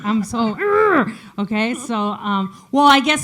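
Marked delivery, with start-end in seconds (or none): 0.6-1.1 bigger butch grunt. Acted. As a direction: really ham up the tough grunt.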